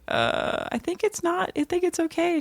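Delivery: put-on high-pitched, hesitant child voice; kids' voices. Kids pleading for an animal, high and hesitant. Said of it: "And the kids are like".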